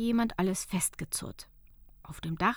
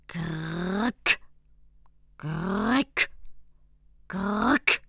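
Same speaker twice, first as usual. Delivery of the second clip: rasping grrrk of a crank, louder each time; crank noise; 4 dB louder, much slower than usual. Acted. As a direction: imitate a creaking crank. Cranking the machine. (knarrendes Kurbelgeräusch)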